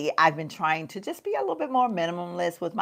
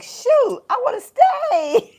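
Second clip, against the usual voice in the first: laughing mock whine; 5 dB louder, slower than usual. Quoting herself missing husband.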